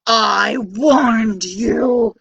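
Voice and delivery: very slow, loud giant boom. Acted. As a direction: boom each word like a giant. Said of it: "boomed the mighty giant".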